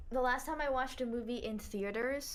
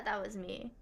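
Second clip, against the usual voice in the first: usual speaking voice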